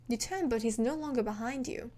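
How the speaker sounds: plain reading voice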